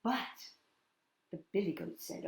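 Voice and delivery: ordinary delivery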